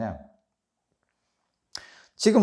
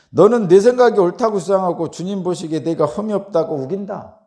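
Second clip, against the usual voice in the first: loud, higher-pitched accusing reading; acted accusation; 6 semitones higher, 8 dB louder than usual. Preacher quoting Zophar accusing Job, loud reproach. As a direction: hurl the charge with raised pitch and volume. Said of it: "막 비난하고 있죠".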